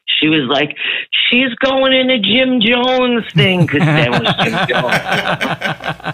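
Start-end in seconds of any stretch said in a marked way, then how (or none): 1.3-3.6 high-pitched, drawn-out mock outrage; mad. Imitating Nana furious about her daughter's new church, mad. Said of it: "She was so fucking mad". 3.6-6.1 high, laughing through the words, drawn out. Real, not acted. Storyteller cracking up at her own impression of Nana, laughing.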